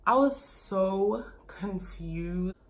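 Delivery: slow, drawn-out whisper; slow stunned whisper. Real, not acted. Telling viewers how lost she felt, slow whisper. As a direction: breathe it out, still in disbelief. Recalling the fiance reveal.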